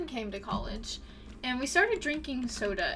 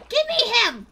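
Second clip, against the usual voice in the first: high, loud, greedy demand; demanding yell; 7 semitones higher, 10 dB louder than usual. Real, not acted. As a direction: yell as you grab for it. Trying to catch the creature.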